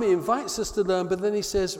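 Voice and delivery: ordinary talk, nothing marked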